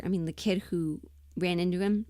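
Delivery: ordinary talk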